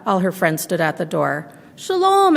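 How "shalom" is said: high, sung-out hello; bright greeting. Acted. Friends calling shalom at the door, bright. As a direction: call out cheerfully at the door.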